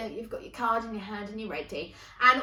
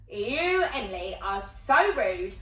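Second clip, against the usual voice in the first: raised, slow, emphatic telling-off; indignant reproach; slower than usual. Retold or imitated. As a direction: scold a friend with rising indignation. Friend scolds her, stronger delivery.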